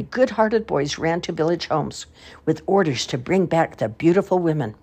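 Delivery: ordinary delivery, nothing marked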